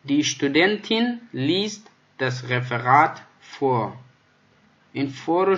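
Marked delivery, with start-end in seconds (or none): 0.1-3.9 slow, careful German; slow model reading. Shown as a demonstration. Teacher to the class, slow careful model sentence. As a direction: read it slowly for the class. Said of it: "teacher reads example sentence".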